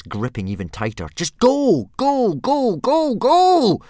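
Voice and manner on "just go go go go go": shrieked commands, much higher and louder; frantic boy screaming at his dragon. Acted. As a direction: scream each go more frantically. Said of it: "screamed Hiccup".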